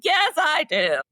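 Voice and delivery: high, crying yes; teary yes. Retold or imitated. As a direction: whimper it through tears. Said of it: "I was like".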